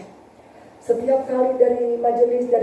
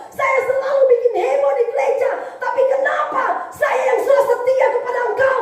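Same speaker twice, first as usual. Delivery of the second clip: much higher, wailing, half-sobbing complaint; tearful accusation; 13 semitones higher than usual. Retold or imitated. Amputee youth tearfully blaming God, high wailing protest. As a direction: cry out to God in betrayed anguish. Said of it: "Dia menangis, saudara, dia menyalahkan Tuhan".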